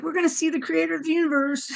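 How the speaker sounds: high, quick, excited chatter; giggly excited little voices. Retold or imitated. Body cells to the woman about meeting the creator, giddily excited. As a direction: chirp it like tiny giddy creatures. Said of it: "they're just giggling and giggling".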